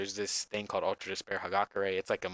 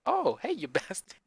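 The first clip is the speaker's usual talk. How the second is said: cheerful insult breaking into a laugh; happy Leon; 9 semitones higher than usual. Retold or imitated. Streamer mimicking Leon's happy line, laughing. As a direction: sing it out, laughing midway. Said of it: "just the way he says it".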